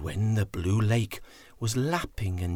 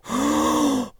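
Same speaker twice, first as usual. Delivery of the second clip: shocked gasp. Acted. Elephants gasp at muddy lake.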